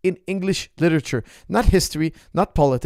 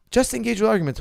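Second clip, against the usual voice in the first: "Just engage with arguments" pitched much higher, insistent; emphatic repeat; 8 semitones higher than usual. Real, not acted.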